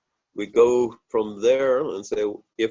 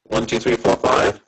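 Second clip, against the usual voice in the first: robotic, glitchy mic distortion; robot-sounding mic. Real, not acted. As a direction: count through a broken, robotic mic. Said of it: "you sound like a robot".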